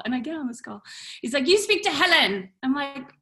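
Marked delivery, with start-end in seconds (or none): 1.5-2.5 put-on high, louder French-accented command; imitated French chef giving orders; put-on French accent, pushy; bad French accent. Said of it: "sorry for my French accent".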